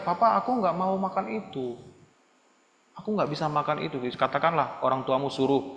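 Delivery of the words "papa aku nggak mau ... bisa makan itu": softly spoken, courteous refusal; polite, gentle child. Acted. Child declining food from papa, gently and politely. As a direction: say no nicely to your father. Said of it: "kamu bisa bilang baik-baik".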